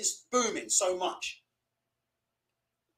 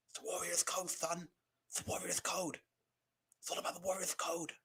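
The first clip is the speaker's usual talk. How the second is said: hushed father; 16 dB quieter than usual. Retold or imitated. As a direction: breathe the code like a secret. Father murmurs code to son.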